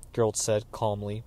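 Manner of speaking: usual speaking voice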